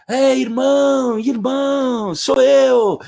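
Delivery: high, loud call through a door. Retold or imitated. Andreuccio banging on the door, high loud calling. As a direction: call to his sister over the noise.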